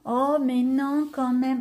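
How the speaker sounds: drawn-out, slow mock protest; coy mock refusal. Retelling a gift offer to viewers, coy mock refusal. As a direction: play at politely refusing, stretching the words slowly.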